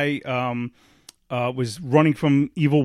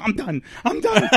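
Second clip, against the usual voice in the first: exasperated quitting, pitch leaping high; hammed-up frustrated cry; mock meltdown; 6 semitones higher than usual. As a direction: give up in a comic squeal. Retold giving up in frustration.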